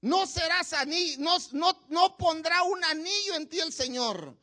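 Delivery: ordinary talk, nothing marked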